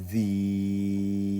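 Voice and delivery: "zi" held extremely long on one level note; double lengthening. Shown as a demonstration. Teacher showing double lengthening, "zi" held very long. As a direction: stretch the vowel of "zi" far out. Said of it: "le double allongement".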